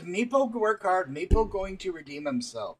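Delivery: a small creature proudly promising to do well; eager little kobold. Acted. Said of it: "He says".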